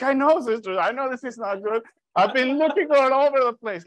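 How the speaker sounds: high-pitched, worked-up retold reply; frantic brother. Brother telling sister he has searched everywhere, frantic.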